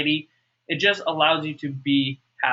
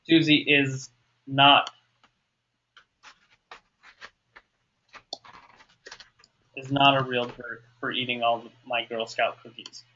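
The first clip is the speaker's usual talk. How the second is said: halting, with a long pause after "not"; dictating while writing; 4 semitones lower than usual. Shown as a demonstration. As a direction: say it piece by piece as you write. Writing out worksheet statement aloud.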